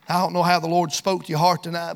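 ordinary talk, nothing marked

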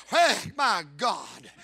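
loud, high preaching climax; preaching at full force. Acted. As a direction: build the shout to the close.

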